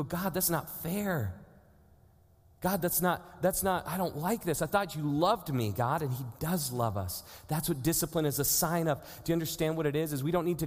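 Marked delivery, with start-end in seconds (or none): 0.0-6.0 whiny complaint. Retold or imitated. Believer whining to God about being disciplined, lightly childish whine. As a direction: sulk at God like a spoiled kid.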